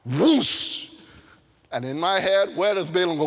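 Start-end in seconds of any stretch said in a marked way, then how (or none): 0.0-0.9 sudden whoosh. Pretend. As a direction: burst out a rushing whoosh. Donkey drops down.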